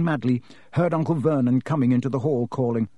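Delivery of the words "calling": plain reading voice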